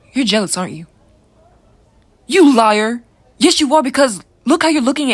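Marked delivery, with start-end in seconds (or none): 2.3-5.1 raised, higher-pitched call-out; loud accusation. Acted. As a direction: loudly call out a friend's lie. Teasingly accusing friend of jealousy.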